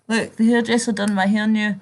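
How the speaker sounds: ordinary talk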